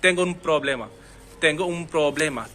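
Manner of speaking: un said as um, running into problema; n becomes m before p. Shown as a demonstration. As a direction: blend un problema into um problema. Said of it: "उन प्रॉब्लम नहीं बोल रहा हूँ मैं".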